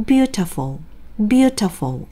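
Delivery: teacher modelling the correct stress; stress on first vowel. Shown as a demonstration. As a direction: punch the first syllable of beautiful. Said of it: "이 단어를 어떻게 읽어야 돼요".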